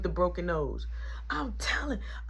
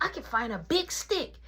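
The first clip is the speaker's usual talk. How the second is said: put-on eager kid; 5 semitones higher than usual. Voices eager little brother.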